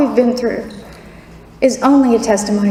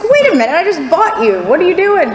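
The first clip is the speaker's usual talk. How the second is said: high-pitched, incredulous mock protest; mock indignation; 7 semitones higher than usual. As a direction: act out baffled indignation, pitched way up. Acted retort to the email.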